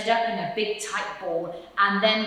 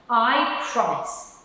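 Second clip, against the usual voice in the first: earnest promise; 5 semitones higher, 5 dB louder, slower than usual. Acted. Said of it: "said the fox".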